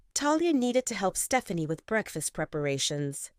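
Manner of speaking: ordinary delivery, nothing marked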